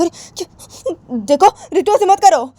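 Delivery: slow high gasp then a loud plea; Jiya's shrill-pitched protest to Ritu; high pleading protest. Acted. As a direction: beg her to stop, up high.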